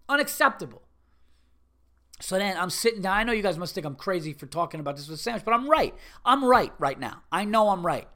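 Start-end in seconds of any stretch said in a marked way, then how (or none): 0.1-0.8 high-pitched, exasperated single word; fed-up customer's ruling; indignant verdict. Acted. Verdict ending an acted complaint. 5.4-8.1 loud, repeated self-justification; defiant certainty, brooking no doubt; emphatic insistence. Real, not acted. Insisting he is right to complain.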